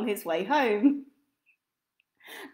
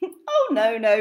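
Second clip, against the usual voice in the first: laughing; 7 dB louder than usual. Acted. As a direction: giggle through the words. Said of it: "laughed little Miss Twin".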